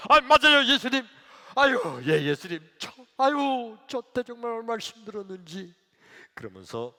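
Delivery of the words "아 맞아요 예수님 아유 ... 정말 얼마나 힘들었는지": high, loud acted outcry; worked-up lament. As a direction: cry out to Jesus in remembered hardship. Acted deacon reliving hardship. (크고 높게 하소연하듯)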